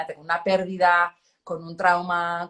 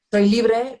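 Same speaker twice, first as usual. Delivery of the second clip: mock boast; 4 semitones higher, 8 dB louder than usual. Mock proud self claim.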